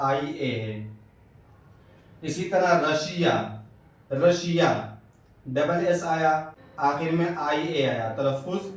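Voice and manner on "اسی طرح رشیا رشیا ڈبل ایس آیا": ordinary talk, nothing marked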